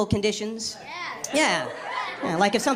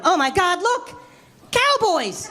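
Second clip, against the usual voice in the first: excited gasp; 7 semitones higher, much slower than usual. Retold or imitated. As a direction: squeal it out in wonder. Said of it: "I'm walking around Houston going".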